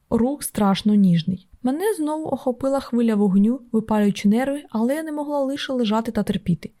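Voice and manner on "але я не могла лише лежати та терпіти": ordinary delivery, nothing marked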